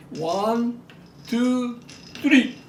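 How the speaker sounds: rising, building count to a loud three; excited countdown. Real, not acted. Demonstrating tops to viewers, counting up to a loud three.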